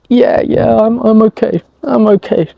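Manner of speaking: high, hurried insistence that he's fine, masking pain; forced i'm okay. Retold or imitated. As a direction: brush her off with a too-bright okay. Forced reassurance while in pain.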